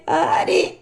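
very high whining echo; echoing cat. A narrator's reading. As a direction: echo the last syllables as the cat. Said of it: "ripeté il gatto".

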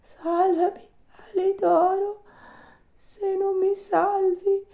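very high, thin little puppet plea; slow, squeaky, failing little voice; tiny feeble voice. Acted. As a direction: beg faintly for rescue, dragging the words. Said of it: "una vocina fioca fioca che disse".

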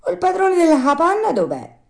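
high, loud puzzled question; astonished. A narrator's reading. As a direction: wonder aloud where the host is. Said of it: "disse Pinocchio maravigliato".

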